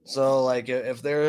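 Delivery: ordinary talk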